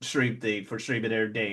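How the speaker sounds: usual speaking voice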